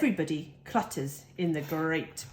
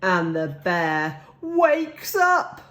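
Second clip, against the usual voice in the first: slow dramatic; 4 dB louder, slower than usual. A narrator's reading. Dramatic turn.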